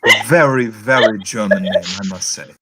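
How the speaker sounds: put-on sceptical, emphatic German accent. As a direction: draw it out mockingly in a German accent. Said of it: "you do the accent of that".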